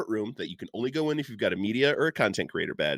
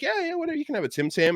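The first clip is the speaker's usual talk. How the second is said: offhand brush-off; faster than usual. Retold or imitated. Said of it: "And I'm like".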